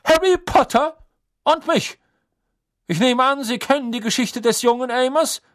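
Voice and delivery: put-on high, loud, stern old official. A narrator's reading. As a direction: lecture him coldly and loudly. Crouch continues his rant.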